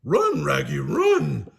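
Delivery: loud cartoon dog yell; Scooby in alarm. Scooby warning Shaggy about the bugs, loud alarmed shout. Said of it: "Scooby cried".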